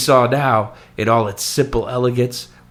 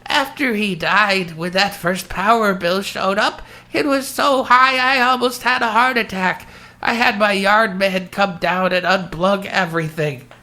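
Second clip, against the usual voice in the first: put-on old lady voice; 8 semitones higher than usual. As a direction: speak like a frail old widow. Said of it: "Did you like my old lady voice".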